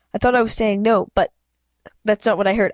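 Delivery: usual speaking voice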